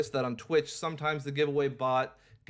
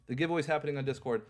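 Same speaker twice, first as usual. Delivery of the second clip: rushed repeat; much faster than usual. Real, not acted. Repeating the announcement.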